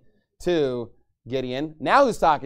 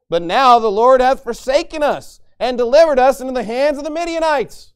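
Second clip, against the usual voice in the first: loud, emphatic complaint, pitched up; frustrated; 4 semitones higher, 8 dB louder than usual. Acted. Gideon answers the Lord who called him mighty, frustrated. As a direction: read it as an exasperated protest to the Lord. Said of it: "It seems like he's a little frustrated".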